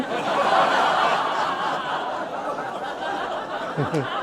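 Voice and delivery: can't hold back the laugh. Real, not acted. Telling the audience how it ended, laughing. Laughing at his own story.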